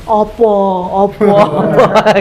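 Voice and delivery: slow echoing call from the well. Acted. Voice from the well echoing back, slow and hollow. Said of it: "suara bergema".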